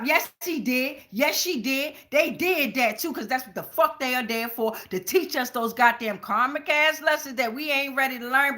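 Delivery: ordinary talk, nothing marked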